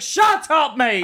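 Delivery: high, exasperated mock shout; mock telling-off. Co-host rejecting the road talk, mock telling-off. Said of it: "It's like".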